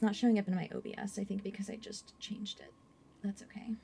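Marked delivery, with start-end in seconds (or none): 1.4-3.7 soft unvoiced aside; whispering. Real, not acted. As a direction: whisper it to yourself.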